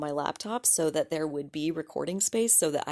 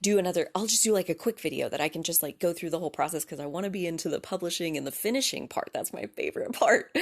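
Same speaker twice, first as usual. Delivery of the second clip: rushed inner monologue; faster than usual. Retold or imitated. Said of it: "So I was like".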